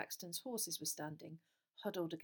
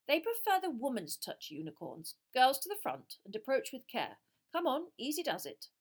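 brisk teacher; 6 semitones higher, 6 dB louder than usual. A narrator's reading. Teacher directing girls.

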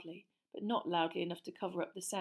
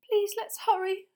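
usual speaking voice

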